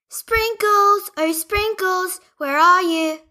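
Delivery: high worried call for a lost dog; Barbie Leah calling for Sprinkles. Acted.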